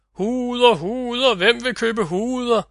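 high street-seller's cry, held long; gaderåb. A narrator's reading. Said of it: "råbte han igen". (højt, langtrukkent sælgerråb)

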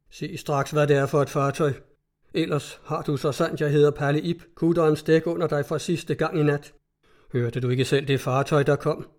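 usual speaking voice

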